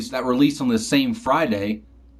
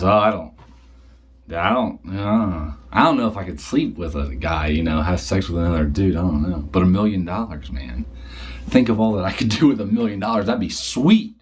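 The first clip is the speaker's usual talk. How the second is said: brother's voice; 6 semitones lower than usual. Retold or imitated. Brother hesitates in story.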